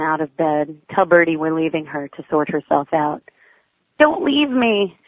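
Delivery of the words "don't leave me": put-on high, slow, pleading child's wail; miserable cry. A narrator's reading. Grumpy child begging parents not to leave the bed. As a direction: beg them not to go, a touch of a whimper. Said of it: "she cries, miserable".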